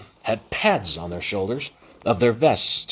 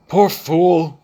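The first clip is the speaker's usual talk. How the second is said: pitying stranger; 7 semitones higher, 6 dB louder than usual. Acted. Stranger speaks beside him.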